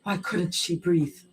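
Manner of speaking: plain reading voice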